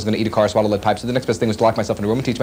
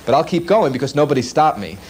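ordinary talk, nothing marked

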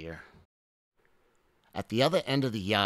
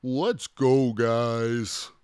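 louder, higher put-on voice; rally the group in a put-on voice; fed-up dinosaur calling friends away; dinosaur character; 3 semitones higher, 7 dB louder, much slower than usual. A narrator's reading. Triceratops leads the others away.

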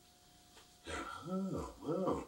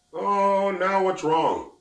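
high, loud and slow call; a high, slow, loud question; 9 semitones higher, 7 dB louder, much slower than usual. Acted. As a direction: reach out to the crying girl. Voice calls to crying girl.

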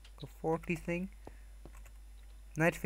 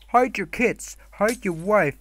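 loud, high mock alarm; mock warning; 3 semitones higher, 7 dB louder, slower than usual. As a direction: call it out like a playful warning.

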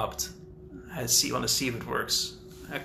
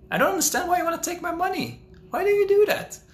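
very high squeak, a bit fast, louder at the end; squeaky baffled plea; 4 dB louder than usual. Pretend. To the robber, a very high squeaky baffled plea. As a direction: squeal the questions like a flustered innocent. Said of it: "and you're like".

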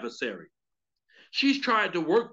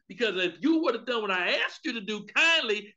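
ordinary talk

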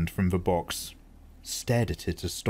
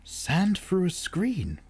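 put-on young Paul, puzzled; 11 semitones higher, slower than usual. A narrator's reading. Boy's voice, slow.

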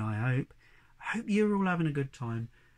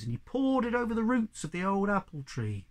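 ordinary delivery, nothing marked

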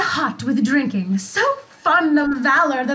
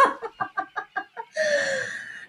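high, delighted spirit's laugh; gleeful laugh; 9 semitones higher, 8 dB quieter than usual. Acted. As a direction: laugh brightly at the fools. Ariel recounts tricking drunk villains.

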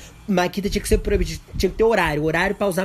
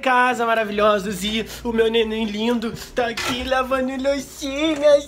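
cooing; 7 semitones higher, 4 dB louder than usual. Real, not acted. Cooing over partner washing dishes.

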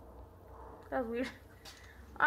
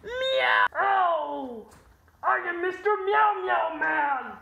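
superhero shout; 2 semitones higher, 7 dB louder, slower than usual. Pretend. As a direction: bellow it like a cat superhero. Superhero transformation as cat.

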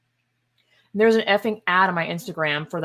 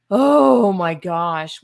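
slow, drawn-out, louder disbelief; exasperated; 7 dB louder, much slower than usual. Real, not acted. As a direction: stretch it out, utterly fed up. Disbelief at law text.

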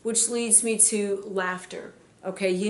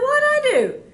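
bewildered; 9 dB louder than usual. Retold or imitated. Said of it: "I'm like".

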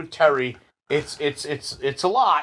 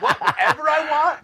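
surprised; 9 semitones higher than usual. Retold or imitated. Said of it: "I was like".

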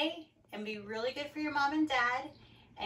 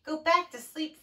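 higher, louder motherly order; lightly voiced mother sending a child off; a hint of a firm mom; frog's mother; 5 semitones higher, 6 dB louder than usual. A narrator's reading. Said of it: "said his mother".

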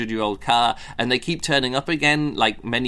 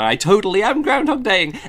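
mock guilty admission; 8 semitones higher, 5 dB louder than usual. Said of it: "He's like".

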